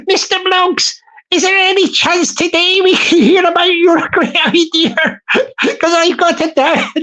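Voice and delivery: high-pitched official, close to giggles; impatient council woman. Acted. Busy council woman pressing Mister Bloggs, high and giggly.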